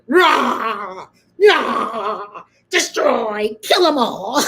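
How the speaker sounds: playful fake divine rage; mock angry god. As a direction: roar like a cartoon wrathful deity. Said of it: "cue the angry god".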